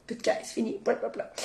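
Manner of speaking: unvoiced muttered aside; whispered aside. Real, not acted. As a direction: drop to a whisper, dismissive. Aparté dismissif à voix basse. (chuchoté, en aparté)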